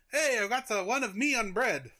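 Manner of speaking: bad, high French accent. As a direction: put on a hammy French accent. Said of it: "This is not a good French accent".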